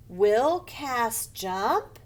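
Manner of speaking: slow question, JUMP lifting at the end. Shown as a demonstration. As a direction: ask it, sliding up on JUMP. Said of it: "our voice goes up".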